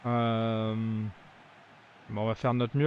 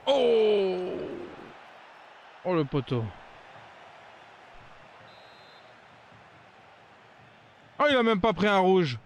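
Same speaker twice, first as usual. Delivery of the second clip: panicking gamer; 6 semitones higher than usual. Real, not acted. Lucky escape, post and no card.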